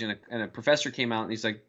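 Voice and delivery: ordinary delivery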